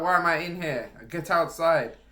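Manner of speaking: imitated teacher shout. Teacher throwing a pupil out of class, shouting. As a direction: order a pupil out, loudly. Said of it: "he's there shouting about".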